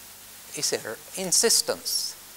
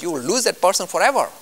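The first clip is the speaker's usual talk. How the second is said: ordinary delivery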